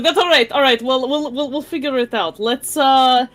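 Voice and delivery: Basha, eager and flustered. Acted. Basha takes charge at the bar, flustered and high. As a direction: play Basha chattering high, building to an excited call. Player voices Basha, then hails bartender.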